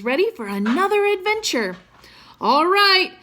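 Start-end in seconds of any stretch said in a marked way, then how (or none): 2.4-3.1 loud, slow, higher command over the wind; yelling an order. A narrator's reading. Dean yelling to the crew to cast off, a loud slow command. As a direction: call it out across the deck. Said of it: "Dean yelled".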